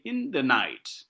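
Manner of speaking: slow phrase landing long on "night"; stress on NIGHT. Shown as a demonstration. Teacher showing which word is accented, stress on "night". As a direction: pass lightly over the start, insist on "night". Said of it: "I insist on night".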